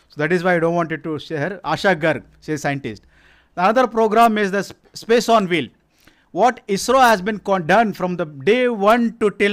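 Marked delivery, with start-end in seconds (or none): none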